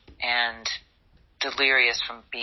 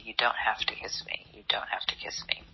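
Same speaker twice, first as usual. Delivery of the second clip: unvoiced whisper, fading softer each time; repeated whisper; 2 semitones lower, 6 dB quieter than usual. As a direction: keep whispering it over and over in her ear. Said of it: "he said it repeatedly".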